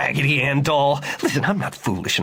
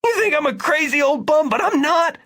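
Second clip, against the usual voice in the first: frantic shouting. A narrator's reading. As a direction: plead desperately to be believed. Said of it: "Victor shouted".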